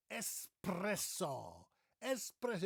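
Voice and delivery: "espresso" as es-PRESS-o; emphatic es-PRESS-o for "espresso"; correct espresso. Shown as a demonstration. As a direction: savour "espresso" with a clean s and no k. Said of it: "It's not espresso".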